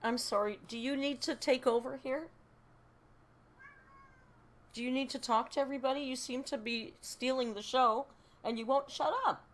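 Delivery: high-pitched pet-owner telling-off; mock scolding. Real, not acted. Owner asking chatty cat to take over, mock scolding.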